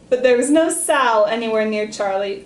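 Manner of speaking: ordinary delivery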